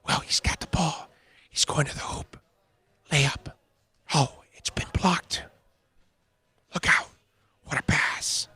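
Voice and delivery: hushed whispered golf commentary; golf announcer hush. Retold or imitated. Mocking fans by calling a hoops game golf-style, whispered. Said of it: "They'll turn into golf announcers".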